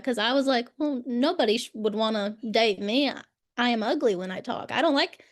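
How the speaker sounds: ordinary talk, nothing marked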